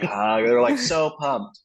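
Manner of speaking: ordinary delivery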